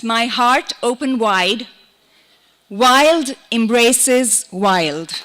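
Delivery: deliberate recital, repeated lines with long pauses; poetry reading. Acted. Poet shares a short wilderness poem with audience, deliberate recital.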